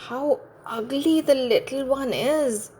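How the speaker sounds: high, put-on mother duck voice, dismayed; a shocked mother eyeing her odd chick; mother duck's dismay. A narrator's reading. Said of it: "she thought".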